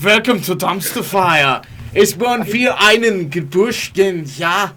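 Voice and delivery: slow put-on German accent. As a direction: play a German announcer, drawing out the words. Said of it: "You do a German accent".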